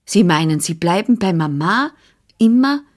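ordinary delivery

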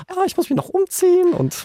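shrill rushed panic, mock; panicked high squeal. (aufgeregt hoch quiekend)

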